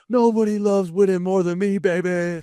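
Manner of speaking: high, excited coach celebrating a win; imitating Coach Q. Player retelling the coach after a big win, high gleeful cheer. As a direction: mimic the coach's gleeful locker-room cheer. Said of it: "be like".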